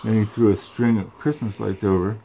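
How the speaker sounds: ordinary delivery, nothing marked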